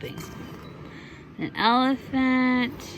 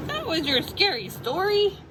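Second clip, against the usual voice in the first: higher, disbelieving question; can't believe it, higher; incredulous Jack; 9 semitones higher than usual. Acted. Mother reading, Jack doubts Emily's story. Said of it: "Jack asked".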